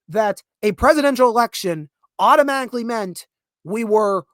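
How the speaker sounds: usual speaking voice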